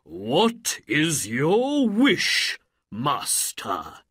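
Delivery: slow, loud genie question, dropping on the last word; summoned genie. A narrator's reading. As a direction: ask grandly like a genie, drawn out. Jinni of the ring appears.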